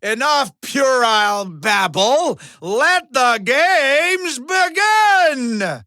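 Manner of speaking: loud, soaring announcer shout; arena-filling proclamation; match-opening cry. Acted. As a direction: stretch it out and send it high. Opens the match.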